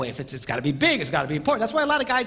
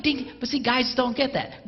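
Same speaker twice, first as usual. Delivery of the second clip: usual speaking voice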